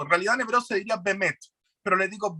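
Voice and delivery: ordinary talk, nothing marked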